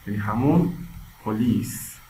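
usual speaking voice

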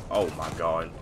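normal talking voice

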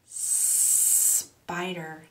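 SPIDER with a long hissed S; long snake sound. Shown as a demonstration. Teacher reads to children, long S. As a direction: linger on the S that starts spider.

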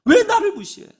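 very high, loud mock outburst; acted angry indignation. Preacher repeating the words behind anger, indignant outburst. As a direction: cry out like someone affronted. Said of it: "무시하는 것이 분노의 원인".

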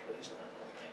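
barely audible whispered plea; hushed inward plea. Retold or imitated. Speaker silently pleading as the heckler stands up, hushed plea. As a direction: whisper it as a private, dreading thought.

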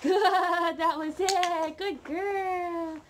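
loud, high, thrilled praise with a slow "that was it"; super excited. Real, not acted. Trainer loses it with joy as puppy nails trick. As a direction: burst out with real delight at the dog. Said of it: "I got so super excited".

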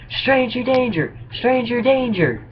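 high, slow mock yell; high drawn-out kid's alarm cry. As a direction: shout it out like a scared kid pointing. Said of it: "points and yells".

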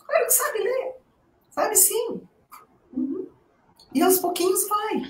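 high, soft, gentle coaxing; teacher gently reassuring a student who says she can't read well. Retold or imitated. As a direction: soften and lift your voice to encourage a shy student.

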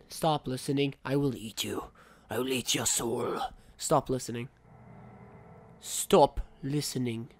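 low, menacing monster voice; mock-sinister low voice; weird cod voice. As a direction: threaten it in a low, silly voice. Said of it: "a really weird cod voice".

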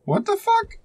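high-pitched shocked yelp; jolted by a jump scare, squeak it out; startled shriek. Real, not acted. Player caught by a sudden scare, shrieking high in shock.

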